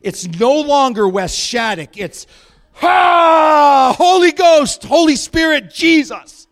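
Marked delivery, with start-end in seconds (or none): 2.7-6.5 high, loud triumphant cry; exultant shout. Acted. Preacher crying out to the Holy Spirit, in a loud high triumphant shout.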